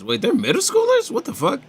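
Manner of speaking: high incredulous squeal; shocked disbelief. Real, not acted. Shocked at middle schoolers.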